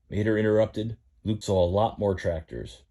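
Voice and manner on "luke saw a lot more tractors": ordinary delivery, nothing marked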